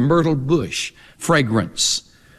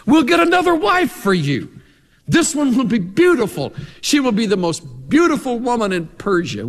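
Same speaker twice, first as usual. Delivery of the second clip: much higher, eager courtier voice; eager courtiers pitching a new wife to the king, voiced higher; king's advisers; 7 semitones higher than usual. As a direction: play the servants pitching a new queen to the king.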